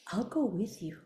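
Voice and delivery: soft, low, tender quote; gentle offer. Retold or imitated. Girl offering to walk up with her, gently soft. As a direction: say it quietly, as reassurance.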